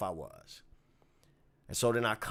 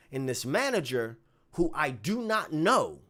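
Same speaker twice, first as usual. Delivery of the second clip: usual speaking voice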